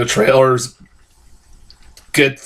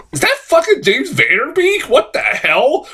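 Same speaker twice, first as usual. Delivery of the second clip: high-pitched mock astonishment; retold disbelief; 11 semitones higher than usual. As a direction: replay your shock at spotting someone, pitch jumping up. Retold shock at a surprise cameo.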